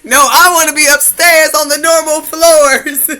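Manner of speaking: put-on high, very loud childish protest; hollered complaint, hammed up for the retelling; mock protest shout. Child refusing the basement class, loud high mock protest.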